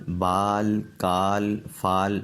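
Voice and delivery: بال, کال, فال said with a flat Urdu "aa": baal, kaal, faal; wrong Urdu-style reading. Shown as a demonstration. Teacher shows the wrong Urdu-style reading of ball, call, fall. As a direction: show the mistake, reading the words with a flat "aa". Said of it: "فال نہیں پڑھنا".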